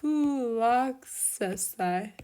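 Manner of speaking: very slow, syllable-by-syllable "fulaxesthai"; sounding out. Shown as a demonstration. Teacher writing out the future infinitive, sounding it out slowly. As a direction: stretch "fulaxesthai" out piece by piece. Sounding out a word being built.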